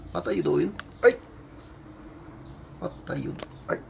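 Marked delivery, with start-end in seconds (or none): none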